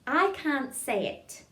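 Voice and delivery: slow, careful diction; tongue-twister demo. Shown as a demonstration. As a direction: say it slowly for a class to copy. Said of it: "Next line is".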